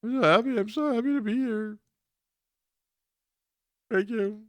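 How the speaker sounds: high, squeaky imitation of tearful gratitude; hammed-up choking up, pitched way high; mock choked up. Radio host mocking the new coach at his press conference, fake choked up. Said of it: "He got choked up".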